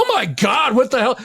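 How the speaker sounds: high, exclaiming retold alarm; hammed-up "what happened" astonishment; shocked. Retold shock at friend in hospital.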